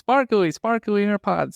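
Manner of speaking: high, slowed mimicry; mimicked traveller's plea. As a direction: mimic someone repeating it for foreigners. Listener mimics asking abroad for AirPods.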